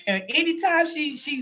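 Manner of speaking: ordinary delivery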